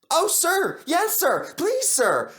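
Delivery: very high, eager pleading yeses; overeager little engine. Acted. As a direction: beg to be picked. Percy voiced eager.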